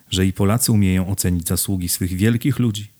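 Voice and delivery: ordinary delivery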